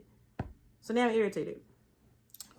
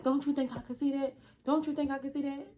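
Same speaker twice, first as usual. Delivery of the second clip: higher, sharp retort; irritated doctor; 7 semitones higher than usual. Retold or imitated. Doctor snapping at his assistant, irritated retort. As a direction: snap back defensively.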